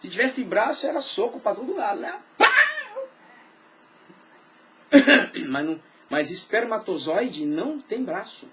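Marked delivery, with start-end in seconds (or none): 2.4-3.1 high, sharp mock punch; punch sound. Pastor acting sperm fighting, punch sound. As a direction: smack the word like a blow.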